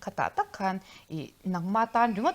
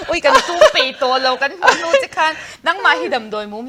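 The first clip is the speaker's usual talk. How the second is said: high, loud words through real laughter; laughing while talking; 8 semitones higher, 12 dB louder than usual. Real, not acted. Telling the co-host a story, laughing loudly. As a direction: keep talking while cracking up.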